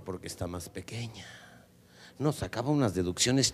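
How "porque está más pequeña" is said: whispered deduction. Acted. Prince's musing trails off.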